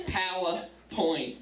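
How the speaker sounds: "PowerPoint" drawn out slowly; slow deliberate repeat. Real, not acted. Comic repeats the punchword to the crowd, slow and deliberate. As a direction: stretch out "PowerPoint" for the joke.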